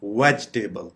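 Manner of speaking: "वेजिटेबल" said VEJ-tay-bul, no i; correct pronunciation. Shown as a demonstration. Teacher modelling the word, "वेजिटेबल" as VEJ-tay-bul. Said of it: "वेज पे जाके आप pause लेंगे".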